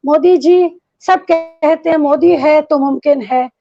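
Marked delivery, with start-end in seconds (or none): none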